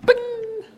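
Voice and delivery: a high, bright sound-effect "bing"; mock "bing" sound. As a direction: pop it out like a magic chime. Mock sound of instant creation.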